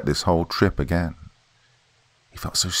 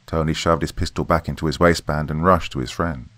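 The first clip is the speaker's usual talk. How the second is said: ordinary delivery, nothing marked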